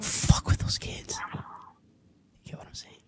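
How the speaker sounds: intense hushed whisper; fierce whispered aside to the caller. Real, not acted. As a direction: lean in and whisper it like a warning. Host's emphatic aside about abusers.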